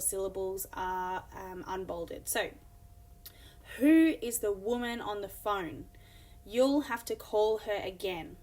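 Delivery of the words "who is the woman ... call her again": "woman", "phone" and "call" stressed in a sing-song beat; rhythmic stresses. Shown as a demonstration. Teacher showing stressed syllables to the class. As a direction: read it with a clear stressed beat. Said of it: "you can hear where the emphasis is".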